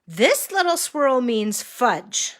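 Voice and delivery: sing-song picture-book voice; reading to a toddler. Acted. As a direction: point out each picture to a small child. Pointing at pictures for child.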